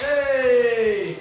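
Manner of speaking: loud high cheer; yelling across the room. Real, not acted. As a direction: call out a happy hey. Cheering at the table.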